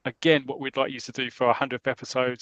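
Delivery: usual speaking voice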